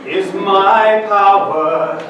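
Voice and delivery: ordinary talk, nothing marked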